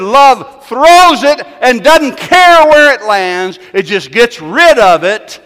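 loud, high, slow proclaiming; booming declaration. Acted. Preacher declaring love's power, booming and slow. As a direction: proclaim it over the room. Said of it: "He said".

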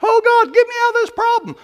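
high-pitched, hurried plea to God; mock desperate prayer. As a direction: wail it like a panicked believer begging for rescue.

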